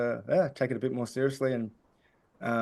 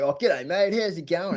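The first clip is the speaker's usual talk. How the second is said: broad Australian ocker greeting, pitched up; Aussie ocker accent; 4 semitones higher than usual. Retold or imitated. Kid bantering with passers-by from the front step, broad Aussie ocker accent. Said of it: "in a really Aussie Ocker accent".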